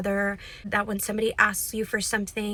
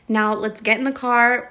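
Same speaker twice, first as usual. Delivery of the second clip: calling out; 8 dB louder than usual. Real, not acted.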